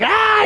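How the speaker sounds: high-pitched retold yell of rage; a fan's high shriek at the coach; high mock scream at a phone screen. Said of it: "screaming on his phone".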